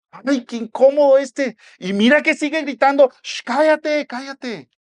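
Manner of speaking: high, loud grumbling into shushing; acted exasperated bystander, pitched high; annoyed crowd scolding. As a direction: complain loudly, then hush him impatiently. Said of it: "lo reprendían para que se callara".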